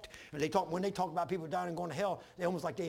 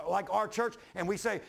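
ordinary talk

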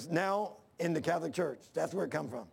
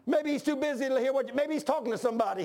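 mocking taunt; 5 semitones higher than usual. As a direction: needle them like Elijah mocking Baal.

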